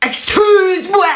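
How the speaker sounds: high, drawn-out mock outrage; indignant disbelief. Mother answers son on phone, outraged. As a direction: stretch it out, scandalized.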